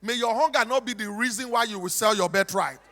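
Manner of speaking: ordinary talk, nothing marked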